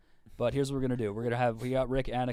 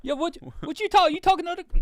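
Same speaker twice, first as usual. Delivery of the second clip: hammed-up Indian accent, higher and louder, laughing through it; a hint of an Indian accent, breaking into laughter; mock Indian accent; 8 dB louder than usual. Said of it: "I lost the accent".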